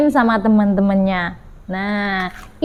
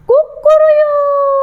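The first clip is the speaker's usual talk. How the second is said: high, drawn-out cock-a-doodle-doo; rooster crow; 12 semitones higher, 5 dB louder, much slower than usual. Acted. Storyteller crows for children to copy, loud rooster call.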